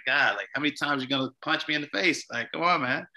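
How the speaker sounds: mildly indignant, pleading with God; exasperated at God. Retold or imitated. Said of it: "just being angry about that".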